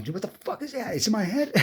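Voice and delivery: high-pitched mock panic; freaked out. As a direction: retell a kid's freak-out, pitch jumping way up. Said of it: "It freaks me the fuck out".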